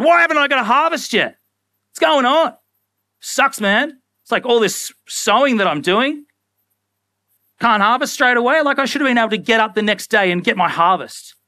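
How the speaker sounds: mock-frustrated farmer griping; impatient farmer. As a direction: complain like a farmer who wants it now.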